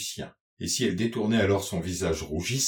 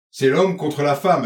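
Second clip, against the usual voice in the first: louder, forceful declaration; mild roar; 3 semitones higher, 6 dB louder than usual. A narrator's reading. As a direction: roar it with restraint, as a narrator would. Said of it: "avait-il rugi". (rugissement contenu, voix forte)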